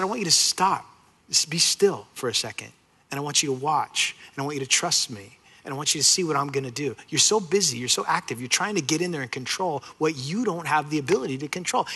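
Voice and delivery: put-on calm a worried child; gentle voice of God. God reassuring his anxious people, soft and tender.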